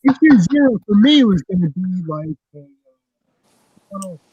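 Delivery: distant, echoing room sound; like talking from a tunnel. Real, not acted. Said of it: "you sound like you're in a cu-, a tunnel".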